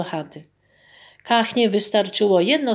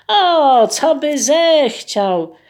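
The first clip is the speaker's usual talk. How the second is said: eager peasant; 7 semitones higher, 6 dB louder, much slower than usual. Acted.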